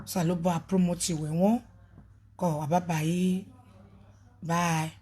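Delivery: usual speaking voice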